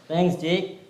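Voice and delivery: normal talking voice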